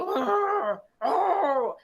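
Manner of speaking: loud, high mock growl. A narrator's reading. Dog growls at the fleeing cats, loud mock growl.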